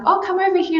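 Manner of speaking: high, mock-gracious beckon; a lightly mocked editor waving you over; imitating Anna. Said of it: "And then she's like".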